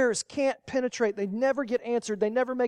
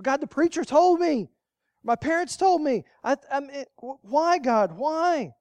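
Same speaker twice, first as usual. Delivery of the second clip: pleading why; 7 semitones higher, 6 dB louder than usual. Retold or imitated. Retold complaint to God.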